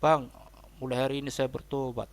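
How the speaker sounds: ordinary delivery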